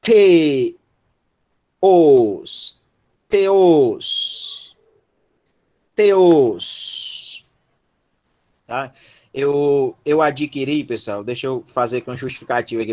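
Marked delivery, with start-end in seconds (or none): 0.0-7.4 "T", "os", then "teos", each drawn out and separated; stretched teacher's demonstration of "teos"; syllable-by-syllable model. Shown as a demonstration. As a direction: build "teos" from its syllables, slowly and firmly. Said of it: "que é a sílaba tônica".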